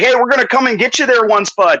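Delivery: high-pitched Canadian Mountie lilt; mock Canadian accent. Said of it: "didn't speak Canadian".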